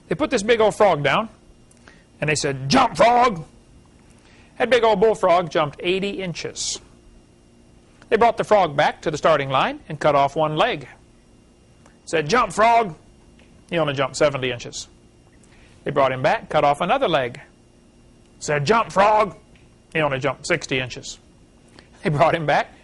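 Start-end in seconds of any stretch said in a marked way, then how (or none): 2.7-3.4 a mock barked order, louder; commanding frog. Scientists ordering a frog to jump, mock command. 12.3-13.0 commanding frog. Retold or imitated. Scientists ordering a frog to jump, mock command. 18.7-19.4 a mock barked order, louder; commanding frog. Scientists ordering a frog to jump, mock command.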